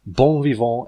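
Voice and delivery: plain reading voice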